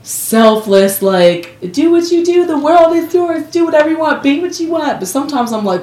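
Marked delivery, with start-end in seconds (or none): 1.7-5.0 high, airy live-and-let-live quote; breezy acted permissiveness. Speaker to anyone, being selfless, breezy and high. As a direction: wave them on in a raised pitch.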